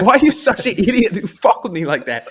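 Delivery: high, loud, laughing scold; laughing mock outrage from the girlfriend; mock indignant girlfriend. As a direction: play the girlfriend, half laughing at being fooled.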